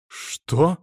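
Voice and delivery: astonished. A narrator's reading. Abbess learns the saint is to be executed, astonished. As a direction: react in disbelief, voice lifting. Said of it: "полезли у наставницы глаза на лоб".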